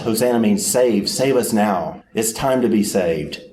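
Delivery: normal talking voice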